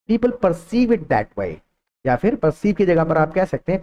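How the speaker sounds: usual speaking voice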